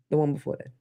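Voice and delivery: ordinary talk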